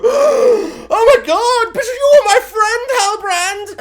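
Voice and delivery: high, loud mock anguish; betrayed wail. Friend wailing at the betrayer. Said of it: "characters around them that go".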